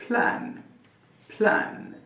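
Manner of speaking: प्लान with the a of bank; model word. Shown as a demonstration. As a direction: say प्लान like the vowel in and. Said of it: "इसमें वो ए का sound आएगा".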